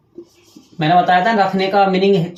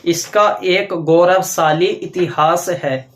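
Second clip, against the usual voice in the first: slow dictation; slower than usual. Shown as a demonstration. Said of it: "तो इसका आगे देखिए".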